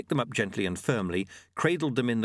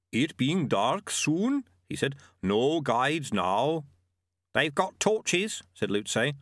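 slow, measured creature voice; slow Yeti voice; 2 semitones higher, slower than usual. Acted. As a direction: speak slowly like a gentle giant.

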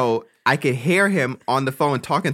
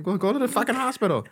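retold urging; 9 semitones higher than usual. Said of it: "I'm like".

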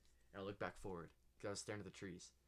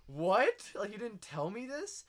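loud high "What?", then a slow, pitched-up complaint; mock outrage at a friend who kept a secret; indignant disbelief; 9 semitones higher, 11 dB louder, slower than usual. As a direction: act betrayed that he never told you.

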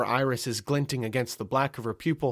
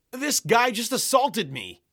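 high, slow, outraged protest; indignant accusation; 10 semitones higher, 3 dB louder, slower than usual. A narrator's reading. Indignant man accuses the narrator.

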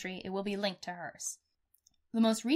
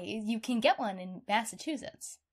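usual speaking voice

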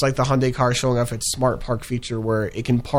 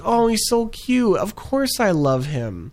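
high, slow, syrupy mock adoration; exaggerated fake gush over a cute baby; 9 semitones higher, slower than usual. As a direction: coo it like a swooning fan, sarcastically. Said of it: "it's just like".

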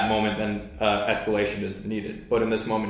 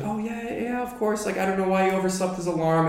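high-pitched imitation of a wife; retold wife's reply, pitched way up; imitating a wife; 10 semitones higher than usual. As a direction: put on a much higher voice as the wife. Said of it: "she's like".

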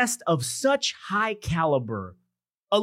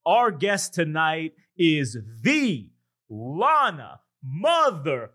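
Bruce Buffer announcer voice; 3 semitones higher, slower than usual. Pretend. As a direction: stretch every title like a ring announcer. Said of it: "let me do my best Bruce Buffer announcer voice".

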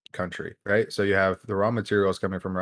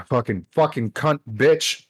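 slow, strained cursing with a burning mouth; mouth burning from spicy food; 4 semitones higher, 5 dB louder, slower than usual. Real, not acted. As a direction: swear through the burn of hot food. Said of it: "my mouth is on fire still".

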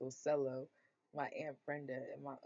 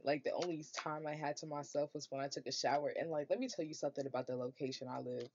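ordinary delivery

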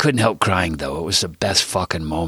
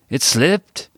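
higher, sheepish little admission; 8 semitones higher, 4 dB louder than usual. A narrator's reading. Chewy admitting to the broken jar, sheepish. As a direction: own up sheepishly, pitched up. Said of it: "he said sheepishly".

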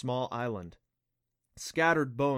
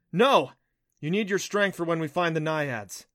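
raised, firm objection; insist, hardest on the first word; insistent; 5 semitones higher, 6 dB louder than usual. A narrator's reading. Adam stopping Neff from shapeshifting, insistent. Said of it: "Adam insisted".

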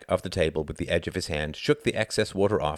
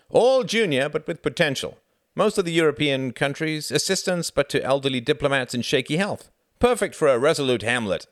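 high-pitched, eager recruiter; hearty Frederick, pitching; 8 semitones higher, 4 dB louder than usual. A narrator's reading. Frederick pitches Foreign Office posts to Reginald, high and eager. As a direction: sell it in a raised voice.

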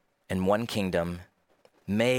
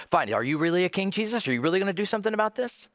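fast, high-pitched sceptical questioning; exasperated challenge; 9 semitones higher, much faster than usual. Acted. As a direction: challenge him impatiently, rushing, voice pitched up. Voices a grudging first response.

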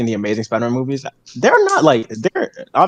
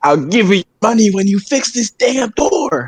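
mock shout; 7 semitones higher, slower than usual. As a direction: yell it like an angry landlord. Quoting someone shouting about door.

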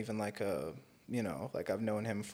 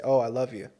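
louder, quick mock profession of love; mock declaration of love; 8 dB louder than usual. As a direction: toss it out loudly, imitating empty affection. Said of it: "sit here and say".

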